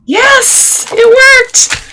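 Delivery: real delighted cheer. Real, not acted. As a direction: celebrate as it clicks into place. Glued piece fits, she cheers.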